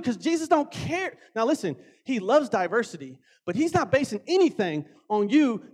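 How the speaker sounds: ordinary talk, nothing marked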